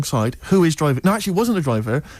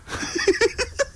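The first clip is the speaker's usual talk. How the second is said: high breathy laugh; 5 dB quieter than usual. Real, not acted. Laughing at Orville joke.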